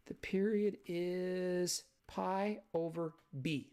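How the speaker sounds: The period is pi over b, said slowly with weight on period; teacher spelling out the period is pi over b; slow emphatic rule. Shown as a demonstration. Teacher stressing the rule to students, slow and deliberate. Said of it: "not two pi over b".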